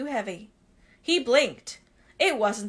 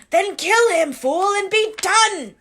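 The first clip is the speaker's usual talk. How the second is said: high, loud furious screech; 7 semitones higher, 6 dB louder, slower than usual. Acted. Voldemort screeching at Quirrell to kill Harry, high and loud. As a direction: screech it at the fool, slowly. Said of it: "screeched Voldemort".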